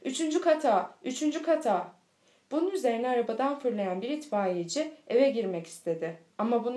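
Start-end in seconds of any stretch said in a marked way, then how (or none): 0.0-1.9 raised calling voice, urgent; urgent shout upward; crowd shouting. A narrator's reading. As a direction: call up to the third floor. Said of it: "Aşağıdakiler bağırışmaya başladılar".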